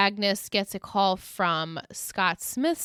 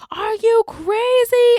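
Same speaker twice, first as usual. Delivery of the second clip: high, slow, loud horrified shriek; hysterical shriek; 11 dB louder, slower than usual. Retold or imitated. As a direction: shriek it like she's lost it. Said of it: "And she's hysterical".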